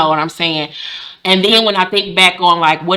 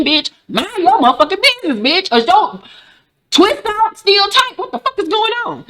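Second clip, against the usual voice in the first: high-pitched, incredulous tirade; hammed-up offended outburst; outraged confrontation; 10 semitones higher than usual. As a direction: go shrieky and indignant at a busybody. Acted outburst at nosy clinic lady.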